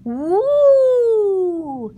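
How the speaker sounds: high, drawn-out spooky woo; ghostly moan. Acted. Piglet hiding in the dark moans to scare his friends, ghostly woo.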